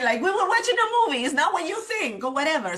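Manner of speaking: high, fast, hammed-up cheater's excuse; mock excuse. Wife mimics husband's flimsy excuse, high and fast. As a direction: rattle off his excuse in a high squeaky rush.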